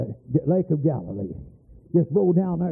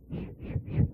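soft unvoiced shushing; hushing shh; 10 dB quieter than usual. Preacher hushing the woman, soft shh. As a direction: shush her gently. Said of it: "I said".

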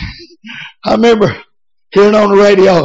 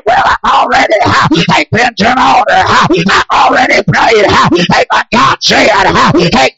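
rising, shouted revival preaching; hoarse-edged revival shout cadence; full-force preaching; 4 semitones higher than usual. Acted. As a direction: preach it hard and build the crowd up. Preacher builds to full cry.

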